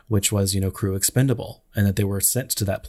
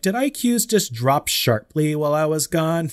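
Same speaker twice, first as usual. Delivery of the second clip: higher-pitched imitation of an indignant outburst; mock hostile; 7 semitones higher than usual. Imitating the movie heroine scolding the company, mock hostile. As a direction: quote her with playful indignation. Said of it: "she was really hostile".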